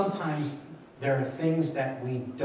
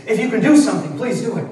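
loud, higher pleading cry; desperate plea; 7 semitones higher, 9 dB louder, faster than usual. Retold or imitated. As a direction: beg him loudly to act. Father begs Jesus for son.

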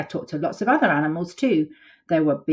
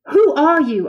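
out of breath, higher question; panting; 11 semitones higher, 9 dB louder than usual. Acted. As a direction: gasp it out breathless. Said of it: "I panted".

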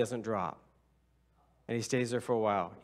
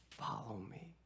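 faint, soft-spoken call; a quiet word from God; hushed inner voice; 2 semitones lower, 15 dB quieter than usual. Retold or imitated. The Lord speaking softly to him.

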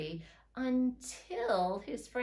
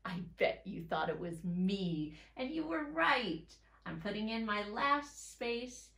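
normal talking voice

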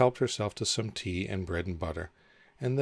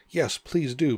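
high, louder, eager plea; pleading; 7 semitones higher, 6 dB louder, slower than usual. A narrator's reading. Alice begs Dormouse for a story, pleading. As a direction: beg with a lift in the voice. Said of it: "pleaded Alice".

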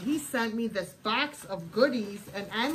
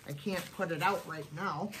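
usual speaking voice

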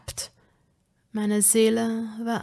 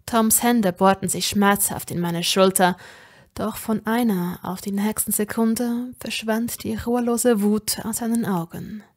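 usual speaking voice

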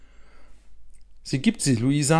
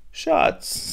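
breathy soft call of a grandmother; grandma whispering to her grandchild; gentle whisper; 3 dB quieter than usual. A narrator's reading. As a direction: whisper the pet name tenderly. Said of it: "sagt Oma".

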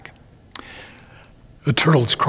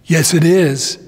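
shouting; 5 semitones higher, 6 dB louder than usual. Acted. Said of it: "shouts to his friend".

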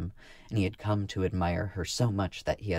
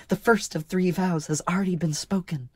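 high, louder, elated announcement; joyful; 11 semitones higher, 5 dB louder than usual. A narrator's reading. Marka telling Jervin of her first vow, joyful. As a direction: proudly share the happy news. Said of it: "she answered joyfully".

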